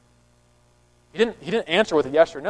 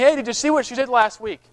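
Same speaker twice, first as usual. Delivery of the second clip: raised, nagging accuser pestering God; accusing Satan; 3 semitones higher than usual. Retold or imitated. Satan accusing a believer before God, nagging and insistent. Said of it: "Satan is saying".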